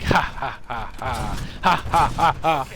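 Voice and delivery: deliberate spelled-out "ha ha"; staged, repetitive mock laughter; imitated laughing. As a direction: fake laugh, beat by beat. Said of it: "So I'm laughing, I'm like".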